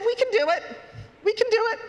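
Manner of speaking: high, eager chant of the birds; eager group cheer. Acted. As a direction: cheer it out like a whole flock.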